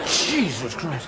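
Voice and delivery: slow, pained groan of words; mock pained endurance; strained under crushing grip. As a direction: squeeze it out through a crushed hand. Reaction to crusher handshake.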